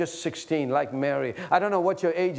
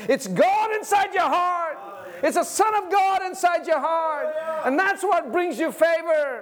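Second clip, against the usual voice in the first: preaching at full force; 11 semitones higher than usual. Acted. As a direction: proclaim it high and hard to the crowd. Preaching climax.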